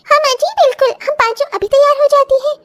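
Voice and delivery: daughters-in-law eagerly agreeing, high and quick; eager young brides. Acted. Daughters-in-law answer together.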